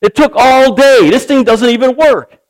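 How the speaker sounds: a lightly played worn-out complaint; frustrated complaint. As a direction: grumble that the saw failed him. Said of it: "He's like".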